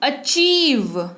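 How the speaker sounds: Achieve drawn out slowly, "a-CHEEV"; slow achieve, weight on the second syllable; stressed long "ee". Shown as a demonstration. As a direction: lean hard on the "ee" in achieve. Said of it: "जोर पड़ा".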